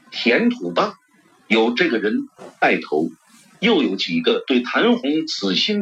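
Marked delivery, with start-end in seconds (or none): none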